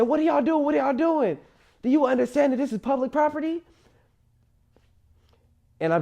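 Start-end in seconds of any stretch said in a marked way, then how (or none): 0.0-3.6 much higher, shocked scolding woman's voice; scolding lady. Retold or imitated. Lady from the gas station scolding the two fighting boys, high and shocked. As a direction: play the outraged bystander.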